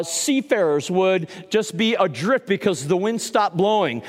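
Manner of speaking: ordinary delivery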